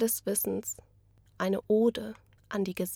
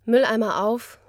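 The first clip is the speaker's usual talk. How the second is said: a louder, punchy call-out; louder exclamation; 7 dB louder than usual. Acted. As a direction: slam it out like a command. Staged poem, punchy exclamation.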